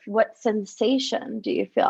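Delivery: ordinary talk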